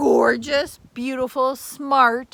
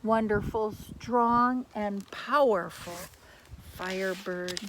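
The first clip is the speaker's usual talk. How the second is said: soft, lower, tender narration; gentle farewell; 7 semitones lower, 8 dB quieter than usual. A narrator's reading. Storyteller softly narrating the Firebird's farewell. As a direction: ease into a calm ending.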